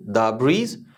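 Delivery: दा ब्रीज with "the" as an open daa; "the" before a consonant. Shown as a demonstration. Teacher showing the consonant rule, open दा. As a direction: say दा, not dee, before ब्रीज. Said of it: "we pronounce it".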